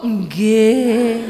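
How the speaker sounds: long, drawn-out docile yes; obedient wife. Acted. Solo wife answering husband's order, drawn-out obedient reply. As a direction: answer in a meek held note.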